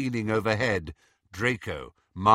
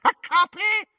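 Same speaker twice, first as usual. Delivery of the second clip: high, loud screech; 7 dB louder than usual. Acted. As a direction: shriek it in disbelief. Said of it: "screeched Bellatrix".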